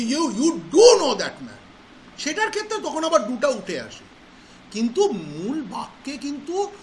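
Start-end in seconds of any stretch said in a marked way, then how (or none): 0.0-1.6 slow, with "do" hit loud; emphatic stress on DO. Shown as a demonstration. Teacher re-explaining to the class, forceful stress on "do". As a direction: stretch and punch the word "do". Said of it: "যদি খুব জোর দিয়ে বলি".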